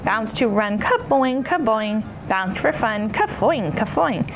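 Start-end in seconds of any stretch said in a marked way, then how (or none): none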